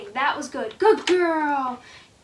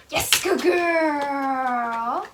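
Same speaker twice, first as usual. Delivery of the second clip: normal talking voice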